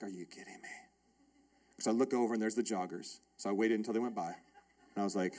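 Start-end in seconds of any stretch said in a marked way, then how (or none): 0.0-0.7 disbelieving mutter. Retold or imitated. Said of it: "And I'm like".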